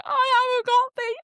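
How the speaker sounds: high, whiny mock-tearful plea; hammed-up fed-up whine; tearful exasperation. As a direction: wail it like you're overwhelmed. Retold tearful outburst on the train.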